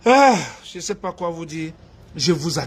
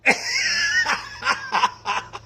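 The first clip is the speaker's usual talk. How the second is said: high shrieking laugh; 4 dB quieter than usual. Real, not acted. Speaker laughing at teasing the sister-in-law, shrieking. Laughs loudly at own joke.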